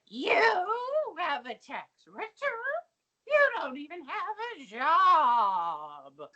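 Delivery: lightly voiced, slow disbelieving Mrs. Garrett. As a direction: drag out a mock scolding. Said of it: "Missus Garrett's like".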